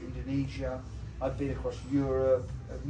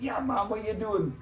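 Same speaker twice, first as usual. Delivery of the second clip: Rasta mate; 5 semitones higher than usual. Retold or imitated. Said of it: "'Cause he was a Rasta".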